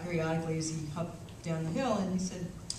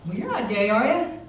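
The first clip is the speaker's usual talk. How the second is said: rising, louder disbelief; lightly voiced old man in shock; puzzled old man; 5 semitones higher, 7 dB louder than usual.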